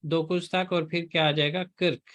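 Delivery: ordinary talk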